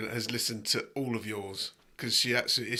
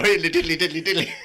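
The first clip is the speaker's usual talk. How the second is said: high, loud, silly sing-song; 5 semitones higher, 7 dB louder than usual. Pretend. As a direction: rattle it off like a daft character. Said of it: "it doesn't come in and go".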